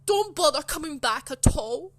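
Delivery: shouted dismissal. Retold or imitated. Teacher to pupil walking out, angry shouted dismissal. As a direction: imitate a teacher yelling after a pupil. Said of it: "she was shouting down the hall".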